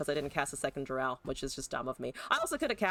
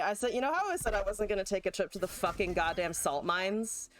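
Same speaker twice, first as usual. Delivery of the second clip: ordinary delivery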